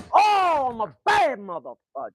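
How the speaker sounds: high sudden yelp fading to a muttered curse; missed shot yelp. Real, not acted. Missing the dartboard, yelping then cursing under breath. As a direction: yelp then grumble at the miss.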